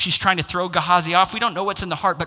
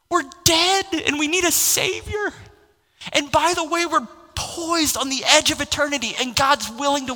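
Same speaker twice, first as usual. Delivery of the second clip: high, urgent preaching at full force; rally the church to urgency from the pulpit; climbing pulpit fervour, near a shout at the peak; 5 semitones higher than usual. Acted.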